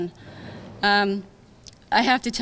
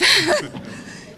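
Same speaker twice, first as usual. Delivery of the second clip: high, brief laugh through emotion; embarrassed teary laugh; 10 semitones higher, 5 dB louder than usual. Real, not acted. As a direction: laugh it off while choked up. Embarrassed laugh after choking up.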